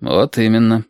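ordinary delivery

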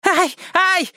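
shrill high yelp; piercing wail. Acted. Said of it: "пронзительно завопил".

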